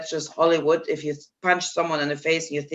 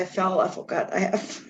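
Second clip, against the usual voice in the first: sheepish mumble; 6 dB quieter than usual. Retold or imitated. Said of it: "I said".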